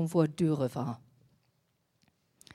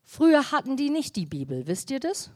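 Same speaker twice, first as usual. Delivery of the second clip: ordinary talk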